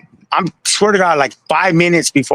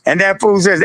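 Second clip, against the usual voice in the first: ordinary talk, nothing marked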